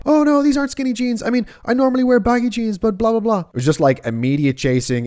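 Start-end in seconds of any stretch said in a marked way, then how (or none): 0.0-3.4 put-on high, quick girlish protest; girly defensive voice. Imitating the girl defending her skinny jeans, high girlish voice. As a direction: mimic her rushing to explain herself.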